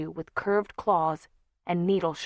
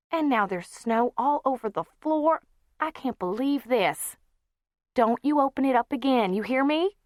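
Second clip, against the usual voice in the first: high, raised scolding voice; scolding aunt; 7 semitones higher, 4 dB louder than usual. Acted. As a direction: tell the child off firmly.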